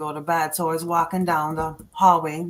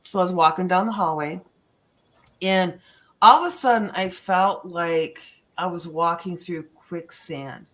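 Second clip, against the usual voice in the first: usual speaking voice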